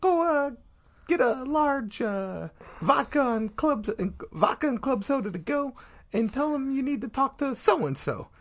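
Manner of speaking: high-pitched put-on bookie voice; bookie impression. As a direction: imitate the old boss giving a kid errands. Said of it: "he talked like this".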